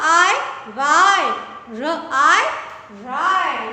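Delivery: each sound blended loud. Shown as a demonstration. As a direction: blend letter by letter, loud and slow. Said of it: "ऊपर से पढ़ के बताइए". (अक्षर जोड़कर ज़ोर से पढ़ना)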